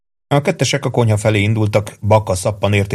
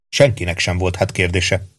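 usual speaking voice

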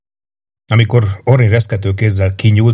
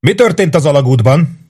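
called across the wall, louder and higher; 7 semitones higher, 4 dB louder than usual. A narrator's reading. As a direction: shout the question over the battle. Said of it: "Rek oda kiáltott".

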